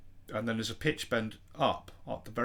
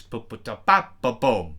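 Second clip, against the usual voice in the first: louder punchy kick-drum syllables; beatboxed kicks; 6 dB louder than usual. Shown as a demonstration. Producer beatboxing the two kicks of the beat. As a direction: thump out the double kick. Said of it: "on to these two kicks".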